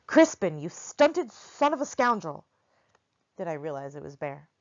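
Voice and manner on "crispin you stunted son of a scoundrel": loud, high angry call, slowed on the insult; furious giant shouting down an alley; angrier shout. Acted. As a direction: bellow the insult in a temper. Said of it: "even angrier".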